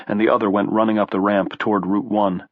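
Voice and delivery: ordinary delivery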